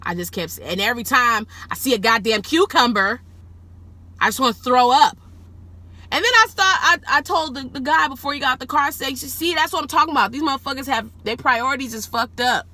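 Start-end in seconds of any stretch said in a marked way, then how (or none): 0.6-5.1 louder, higher exclamation of revulsion; exasperated, raised-voice disgust; disgusted outburst. Real, not acted. Venting disgust at cucumbers. 6.1-12.6 high, loud, quick-fire complaint; retold exasperated tirade; indignant rant.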